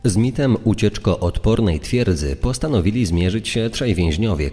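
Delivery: ordinary delivery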